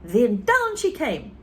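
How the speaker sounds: usual speaking voice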